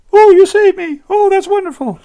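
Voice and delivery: loud, very high gushing; very high delighted woman; high maiden voice. Acted. Brunhilde wakes.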